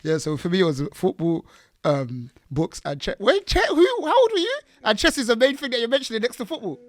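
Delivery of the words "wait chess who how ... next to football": high, incredulous questions; can't believe what she heard; surprised disbelief. Real, not acted. Host amazed at chess mention.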